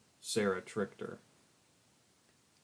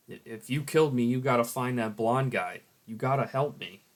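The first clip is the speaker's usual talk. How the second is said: desperate plea; 4 semitones higher, 7 dB louder than usual. Acted. Pleading to be killed.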